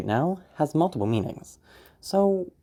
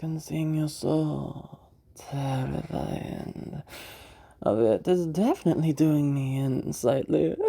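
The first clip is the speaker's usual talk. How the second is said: very slow, higher feminine voice dragging key words; feminine villain voice; 3 semitones higher, much slower than usual. Shown as a demonstration. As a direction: savour the words, slightly off and menacing. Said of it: "I'm gonna be using a feminine voice".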